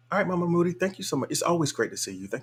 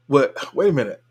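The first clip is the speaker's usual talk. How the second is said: real double-take, higher voice; baffled; 4 semitones higher, 5 dB louder than usual. Real, not acted. Streamer reacting to a viewer's braille menu story, baffled. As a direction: pull up short in higher-pitched disbelief.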